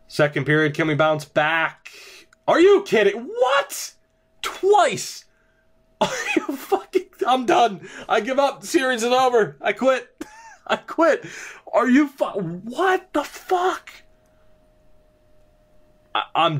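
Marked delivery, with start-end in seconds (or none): 2.5-7.1 can't believe what he's seeing, cracking high; stunned disbelief. Real, not acted. Two last-second goals against his team. 7.3-11.2 much higher, half-laughing I quit; exasperated giving up. Real, not acted. Gives up after shocking goal. 11.7-13.9 high, slow, stretched-out disbelief; shocked outburst. Real, not acted. Still reeling from second goal.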